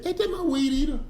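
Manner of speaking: high, accusing question about the weed eater; mock indignation. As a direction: act outraged at spotting your stolen tool. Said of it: "you say".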